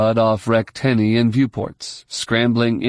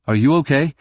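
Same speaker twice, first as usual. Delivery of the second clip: mildly insistent question, raised pitch; demanding; 4 semitones higher, faster than usual. A narrator's reading. Jacen checking on Jaina after the fall, demanding. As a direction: press lightly for an answer. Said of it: "he demanded".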